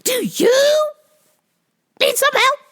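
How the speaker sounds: high, loud, slow mock yell; hammed-up rude customer yelling; mock shout. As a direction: shriek back the question in mock outrage. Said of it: "the lady was like".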